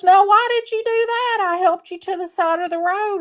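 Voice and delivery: high, hurt cry of disbelief, quickening at the end; high-pitched farmer protesting the snake's bite; betrayed farmer. Acted. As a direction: squeak it out high like a man just bitten. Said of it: "the farmer goes".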